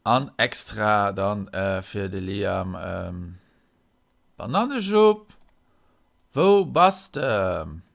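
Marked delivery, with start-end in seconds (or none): 4.4-7.8 loud, high sing-song call; playful summons, pitched up. Pretend. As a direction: call out for a playmate, drawing it out. Calls for the Bananenschub character.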